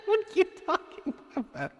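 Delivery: very high, squeaky words through laughter; soft falsetto wheeze of disbelief; helpless laughter. Real, not acted. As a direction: squeeze the question out while cracking up. Cracking up at viewer's complaint.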